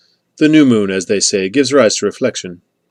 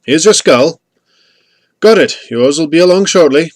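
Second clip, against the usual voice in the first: high, loud calls back and forth; raised trading voice, pitched up; calling out; 6 semitones higher, 7 dB louder than usual. A narrator's reading. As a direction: call out so it carries over the hill. Traders calling across a dark hillside.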